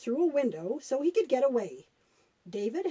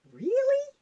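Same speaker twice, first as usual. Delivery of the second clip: surprised; 12 semitones higher than usual. Acted. Child puppet amazed by the story.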